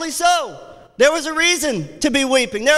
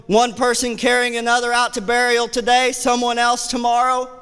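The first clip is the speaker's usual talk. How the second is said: usual speaking voice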